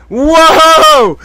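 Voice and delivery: very high, loud whoop breaking into laughter; real astonished outburst; amazed. Real, not acted. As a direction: whoop in disbelief, then laugh. Amazed by what the clip reveals.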